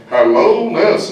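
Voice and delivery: a big Liverpool cartoon voice. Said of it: "So I'm like".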